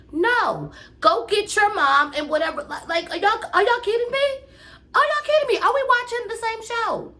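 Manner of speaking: very high-pitched, incredulous exasperation; exasperated outburst. Real, not acted. Vlogger venting at the reality show cast, exasperated. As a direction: let disbelief send the voice sky-high. Exasperated rant about the cast's behavior.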